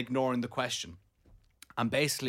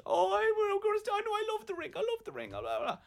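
very high falsetto whimper; mock distraught partner; 3 dB quieter than usual. Partner coming out to choose the ring, mocked in falsetto. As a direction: squeak it out, imitating a flustered partner. Imitates partner returning from the room.

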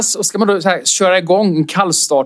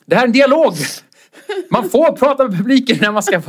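higher, heated telling-off; scolding; 8 semitones higher than usual. Retold or imitated. Comic telling off the heckler, heated and higher. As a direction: put the heckler in her place. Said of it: "skällde ut honom".

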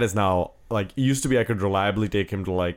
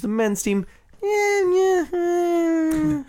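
high pained groan of disappointment; anguished high eh; much slower than usual. Pretend. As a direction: whine it out like a hurt fan. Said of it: "the sounds he made".

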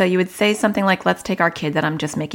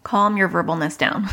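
ordinary delivery

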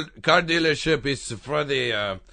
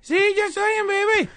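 put-on baby voice. Baby voice on prank call.

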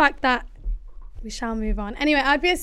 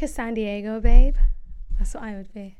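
ordinary talk, nothing marked

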